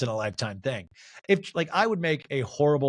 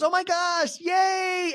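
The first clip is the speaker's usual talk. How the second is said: excited shriek; much slower than usual. Retold or imitated. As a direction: squeal it like a thrilled fan. Monica cheering over drag plan.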